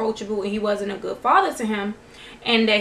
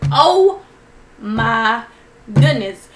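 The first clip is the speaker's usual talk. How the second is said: shocked disbelief; 8 dB louder, much slower than usual. Retold or imitated. Viewer reacting to a father's hurtful words, shocked. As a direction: stop on each word, appalled.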